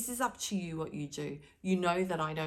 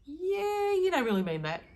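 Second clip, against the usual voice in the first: high, louder scoffing "yeah"; sarcastic disbelief; 13 semitones higher, 6 dB louder than usual. Retold or imitated. Answering a hollow apology, mock scoffing disbelief. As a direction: call out the fake apology with a scoff. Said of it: "and I'm going".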